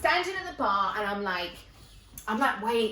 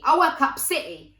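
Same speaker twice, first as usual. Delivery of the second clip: loud, higher mimicked boast; city-worker catchphrase; 4 semitones higher, 8 dB louder than usual. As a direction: announce it like a man's stock line. Quotes the men's stock line.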